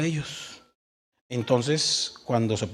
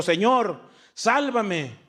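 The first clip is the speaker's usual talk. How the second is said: mock cry for help; 6 semitones higher, much slower than usual. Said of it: "dio voces diciendo".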